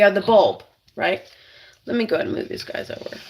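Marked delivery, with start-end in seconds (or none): 1.8-3.2 mutter aside. Real, not acted. Aside while moving veggies.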